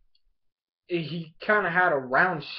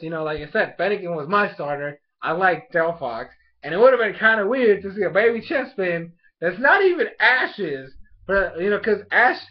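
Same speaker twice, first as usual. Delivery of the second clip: ordinary talk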